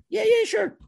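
very high, loud mock-cheery reply; eager agreement. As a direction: pipe up eagerly as the summoned rep. Sales rep answers call from afar.

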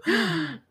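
sharp high gasp of shock. Retold or imitated. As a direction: gasp as if caught out. Mama zna imię dziewczyny.

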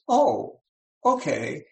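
lightly voiced agreeable brain; high "oh", then a readily accepting okay; compliant brain. Brain obediently agreeing in talk.

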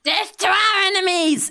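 high, loud war cry; hammed-up charging yell; yelled battle cry. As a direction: shout it while leaping from hiding. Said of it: "Ping yells out".

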